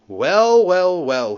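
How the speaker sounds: high, loud knowing exclamation; mock surprise. A narrator's reading. Percy teasing Thomas the next morning, mock surprised. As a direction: feign amazement with a teasing edge. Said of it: "he exclaimed".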